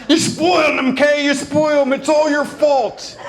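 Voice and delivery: high, loud mock blame; a hammed-up bickering father; dad blaming. Dad blaming mom for spoiling the son, loud and high. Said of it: "And my dad's".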